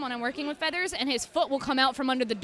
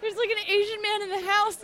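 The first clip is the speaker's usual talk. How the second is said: mock alarmed; 9 semitones higher than usual. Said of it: "He goes".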